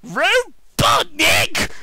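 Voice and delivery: high, loud, drawn-out exclamation; shocked announcement. Acted. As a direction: cry out the name in melodramatic horror. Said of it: "Sonic announced".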